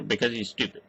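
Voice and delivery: ordinary talk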